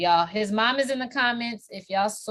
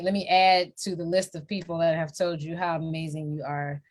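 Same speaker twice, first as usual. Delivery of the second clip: normal talking voice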